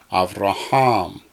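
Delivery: "Avraham" slowly, weight on HAM; accent on last syllable. Shown as a demonstration. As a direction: draw out av-ra-HAM. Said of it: "accent on the last syllable".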